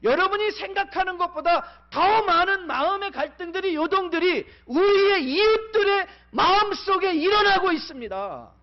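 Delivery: high, loud pulpit cry; a pastor at full force, voice ringing high; fiery preaching. Acted. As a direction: preach it out to the whole hall.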